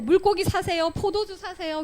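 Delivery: quick mock street-seller cry; market vendor's call. Vendors hawking at the temple gate.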